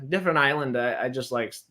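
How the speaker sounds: usual speaking voice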